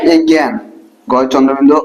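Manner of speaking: usual speaking voice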